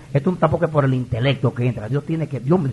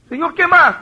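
high, loud acted question; exasperated plea; 14 semitones higher, 10 dB louder than usual. Pastor asking God what else He wants, exasperated plea. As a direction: call up to God, at wits' end.

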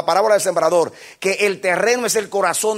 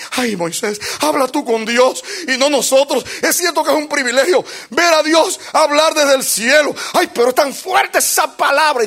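Israel begging; 6 semitones higher than usual. Acted. Israel begging Moses to speak to God for them, frightened. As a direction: cry out to Moses in fear.

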